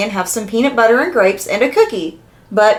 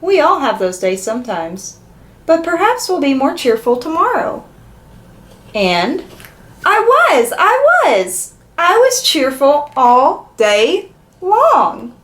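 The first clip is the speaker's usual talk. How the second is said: animated storybook read, building to high, emphatic repeats; expressive children's story narration; 3 semitones higher, slower than usual. Acted. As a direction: read it to little ones, stretching out the last line. Reads a picture book aloud.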